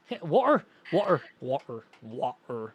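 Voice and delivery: a hint of a Scottish "water"; imitating Echo's accent. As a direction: roll the "water" like Echo.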